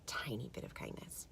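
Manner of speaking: soft unvoiced trailing whisper. Real, not acted. Urging viewers to be kind, whispered. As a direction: let the last words fall to a whisper. Closing message, voice dropping.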